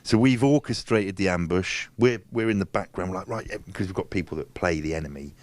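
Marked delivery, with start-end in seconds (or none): none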